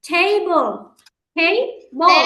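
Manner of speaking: টেবল split into two loud beats; teacher models টেবল for children; two syllable demo. Shown as a demonstration. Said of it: "টেবল দুইবারে বলি".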